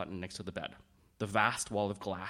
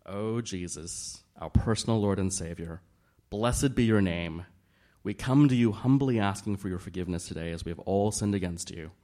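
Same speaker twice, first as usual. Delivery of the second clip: praying aloud; 5 dB louder than usual. A narrator's reading. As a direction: lift it up to the Lord. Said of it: "began to pray out loud".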